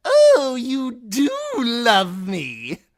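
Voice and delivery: high, slow mocking fool; jester voice. The fool refusing Timion, high and mocking. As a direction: toss it back like a cackling jester.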